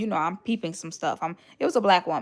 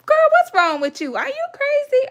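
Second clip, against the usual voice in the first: much louder, much higher "are you crazy"; exasperated outburst; 10 dB louder than usual. Real, not acted. To the audience about the landlady, loud exasperated outburst. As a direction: blow up in disbelief. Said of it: "I almost called that lady something".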